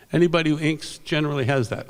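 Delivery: usual speaking voice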